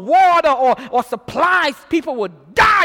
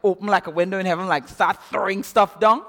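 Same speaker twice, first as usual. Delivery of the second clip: sarcastic; 7 semitones lower than usual. Retold or imitated. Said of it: "he started being sarcastic".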